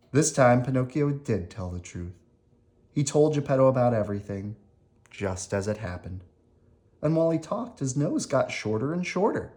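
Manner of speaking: ordinary delivery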